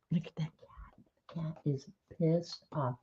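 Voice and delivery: breathy whispered aside about the cat. Real, not acted. To the chat about the angry cat, a soft whisper. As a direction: whisper it under your breath. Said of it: "aside whispered".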